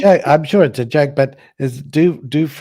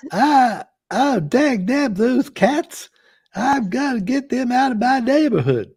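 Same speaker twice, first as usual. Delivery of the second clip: put-on hillbilly accent; 7 semitones higher than usual. Showing co-hosts his practised hillbilly accent, high and drawled. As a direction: ham up a Southern hillbilly. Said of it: "let's hear your accent".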